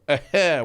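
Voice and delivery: short high, loud laugh burst; imitated grandma's laugh. Grandson imitating the laugh that set them off, loud burst. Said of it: "when you would hear her go".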